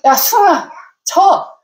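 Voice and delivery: mother calling sharply across the room to daughter, irritated; angry call. Retold or imitated. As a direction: snap her name out, fed up. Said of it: "저 화가 나서".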